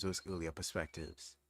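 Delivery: ordinary delivery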